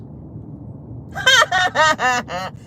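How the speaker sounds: mocking put-on laugh; Carlos's stupid laugh. Host imitating Carlos's laugh when confronted, stupid mock laugh. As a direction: fake a long, silly laugh. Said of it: "He did that stupid laugh again".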